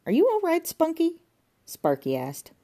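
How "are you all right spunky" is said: Sparky's high voice. Acted.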